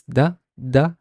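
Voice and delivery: da as one short syllable; teacher demonstrating da; sounding out. Shown as a demonstration. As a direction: model da on its own. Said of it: "How would I pronounce this?".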